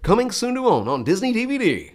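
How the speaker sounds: high, loud announcer trailer read; Mark Elliott impression. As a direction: sell the DVD like a big movie announcer. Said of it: "there was Mark Elliott".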